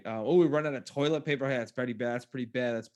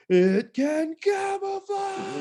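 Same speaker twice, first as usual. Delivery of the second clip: much higher, very slow exaggerated shout; mock proclamation; 5 dB louder, much slower than usual. Scientist announcing the new ability, hammed-up shout. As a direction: stretch it out like a grand reveal. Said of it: "When they just went".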